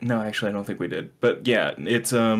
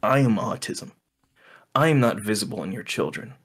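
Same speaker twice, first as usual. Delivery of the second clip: ordinary delivery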